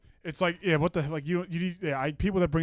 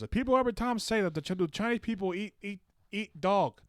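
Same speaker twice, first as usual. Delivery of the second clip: higher, mock Chinese accent of a classmate; quoting the Chinese kid with his accent; imitating Chinese accent; 6 semitones higher than usual. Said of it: "And he goes".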